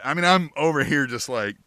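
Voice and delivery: ordinary talk, nothing marked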